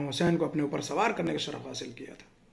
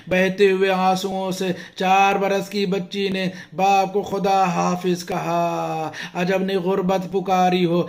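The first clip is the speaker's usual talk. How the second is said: loud, wailing majlis recitation, rising higher; impassioned preaching; 3 semitones higher, 7 dB louder, slower than usual. Acted. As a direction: preach the grief at full force, voice raised. Grieving majlis preaching climax.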